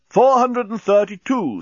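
slow, high, loud count; slow countdown. A narrator's reading. Bomb counting down.

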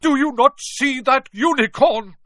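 high, loud furious bellow. Acted. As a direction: roar it at the rival centaur. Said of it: "Firenze bellowed at Bane".

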